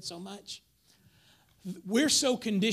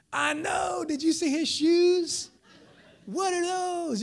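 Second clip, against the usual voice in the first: high, loud mock outrage; eager gossip joining in; 8 semitones higher, 4 dB louder, slower than usual. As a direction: pile on gleefully, voice pitched way up.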